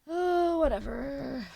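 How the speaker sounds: Oh jumps high and loud, whatever falls away; sarcastic sing-song "Oh, whatever."; mock sarcastic whatever. Shown as a demonstration. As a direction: toss it off with a hammed-up flourish. Repeats whatever, then mocks it.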